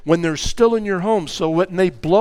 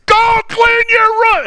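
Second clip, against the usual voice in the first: much higher, louder, slow yell; explosive shout; 6 dB louder than usual. Retold or imitated. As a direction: blow up like a volcano. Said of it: "they wait until number ten".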